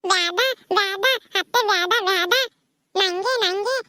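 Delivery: high put-on toddler voice; whiny tot wanting it all; little child. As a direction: plead like a small child. Little child refusing to share treat.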